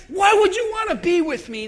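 high, loud self-pitying cry; Naomi's lament. Retold or imitated. Naomi crying out to Ruth in self-pity. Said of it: "She said".

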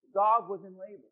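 usual speaking voice

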